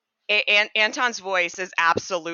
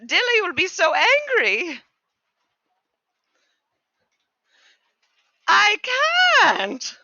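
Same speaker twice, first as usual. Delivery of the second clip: high, squeaky, worried voice; a frightened little servant pleading; squeaky, slow, strained fuss; fretting creature; 8 dB louder, slower than usual. Acted. Anton frets, squeaky voice.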